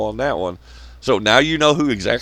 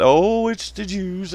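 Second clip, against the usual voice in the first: mock crying; 7 semitones higher, slower than usual. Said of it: "crying them, them bitch tears".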